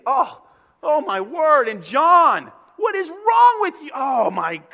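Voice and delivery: loud, high, fed-up yelling; Jesus yelling at John and Peter, loud and exasperated; exasperated shout. Retold or imitated. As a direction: yell at the disciples in exasperation. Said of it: "that's how I would act".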